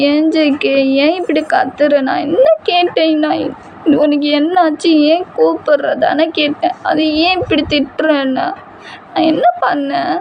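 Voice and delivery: high-pitched, upset pleading at normal volume; tearful protest. Acted. Girl asks JK why he is scolding her, tearful protest.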